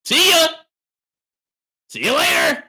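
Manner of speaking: high, loud dismissive shout; hollered send-off. Real, not acted. Streamer dismissing a complaining viewer, hollering. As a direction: holler them out of the room.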